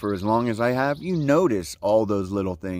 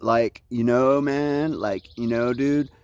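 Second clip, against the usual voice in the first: "Like, you know, man, like, you know, dude" in a laid-back surfer drawl; mock Spicoli surfer dude; surfer dude talk; 2 semitones higher, slower than usual. Shown as a demonstration. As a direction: play up your own surfer slang. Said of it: "I got that".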